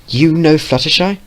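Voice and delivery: high, rising surprise; surprised. Acted. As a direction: ask it in disbelief. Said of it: "the ponies said at the same time".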